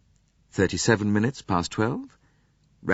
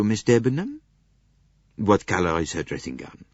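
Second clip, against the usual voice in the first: plain reading voice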